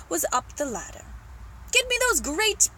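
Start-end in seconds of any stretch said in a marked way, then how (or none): 1.7-2.7 high, insistent shouted order; shouting. Acted. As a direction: shout up to someone on a ladder. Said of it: "the old man was shouting".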